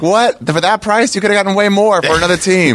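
high incredulous outburst. Retold or imitated. Fan baffled by the Pau Gasol trade, high disbelief. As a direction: pitch it way up in disbelief. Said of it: "I'm like".

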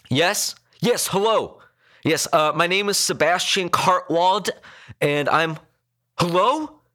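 high, urgent caller, halting; frantic phone call. A narrator's reading. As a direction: try anxiously to get through on a bad line. Frantic emergency call breaking up.

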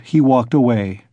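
ordinary delivery

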